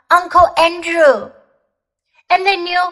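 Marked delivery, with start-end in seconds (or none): 0.1-1.3 a mild louder shout of the name. A narrator's reading. Diggory remembers all at once, mildly shouting the name. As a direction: call the name out as it comes back. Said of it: "he shouted out".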